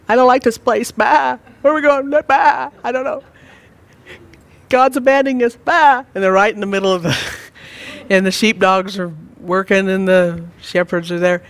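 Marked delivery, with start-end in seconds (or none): 0.1-6.0 high, bleating worried sheep; panicky sheep voice. Retold or imitated. Preacher voicing a frightened sheep being led, high bleating whine. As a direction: whine like a scared lost sheep, higher and louder. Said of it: "I don't know, it might be".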